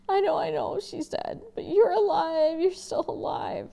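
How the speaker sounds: high, loud, breaking laugh-cry; relieved outburst. Acted. Boy to his father after the news, laughing through tears. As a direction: let relief crack the voice.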